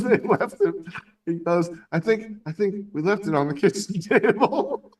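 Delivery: high, giggling retold quote; barely holding in the laugh; laughing through it. Telling friends the dildo was left on the table, laughing. Retelling punchline, cracking up.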